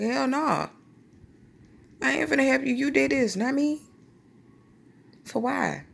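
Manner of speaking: high-pitched imitation of a brother; high, protesting put-on voice; mock indignant refusal. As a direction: refuse to help, acting out a sassy sibling. Acts brother refusing to help.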